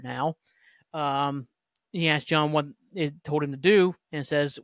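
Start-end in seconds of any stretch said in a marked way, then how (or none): none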